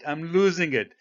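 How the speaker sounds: mock exasperation, voice rising; half-playful frustrated outburst; exasperated. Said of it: "So I'm getting upset now".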